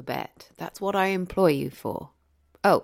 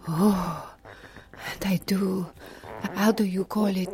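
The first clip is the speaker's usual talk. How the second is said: hushed narration. Acted. Narrating coffee making, hushed.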